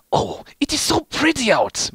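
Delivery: high, airy swooning voice; Medic sighing. A narrator's reading. Narrator voicing Medic gazing at sunset, high swooning sigh. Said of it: "Medic said with a sigh".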